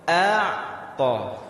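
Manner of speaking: ordinary talk, nothing marked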